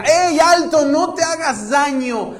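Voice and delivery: high, loud shout to stop him. Acted. Paul shouting to the jailer about to kill himself, loud. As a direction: call out urgently to halt him. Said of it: "Pablo grita".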